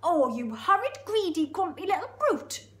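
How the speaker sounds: high, loud, indignant Geordie; Geordie hippo. Acted. Hippo crying out at the crocodile, indignant Geordie. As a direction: scold in an outraged Geordie voice. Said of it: "It's really Geordie".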